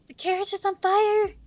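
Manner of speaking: a hammed-up cry that the carriage burns; mock alarmed character line. Shown as a demonstration. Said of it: "I have no idea how to voice this part".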